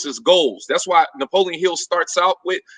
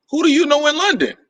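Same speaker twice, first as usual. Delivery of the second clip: higher, quick mock mom; mom's voice; 11 semitones higher, faster than usual. Mom questioning son's London plans, high mock voice. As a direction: imitate a doubtful mom, pitched up. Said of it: "So she says".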